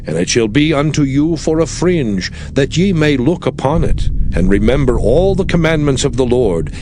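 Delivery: usual speaking voice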